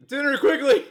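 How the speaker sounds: high, loud, laughing order; hammed-up bossy demand; mock imperious command. Mock demanding movie line.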